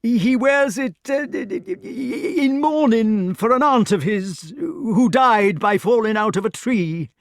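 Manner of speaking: high, loud, slow, fumbling excuse; a nervous host inventing a dead aunt; flustered Sir Ector. A narrator's reading. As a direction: make up the story as you go.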